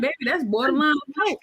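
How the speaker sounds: quick, dry aside to a rough lover; mock deadpan retort. Telling a rough lover off, quick and dry deadpan. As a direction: fire it off fast, half-joking. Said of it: "And I'm sitting here like".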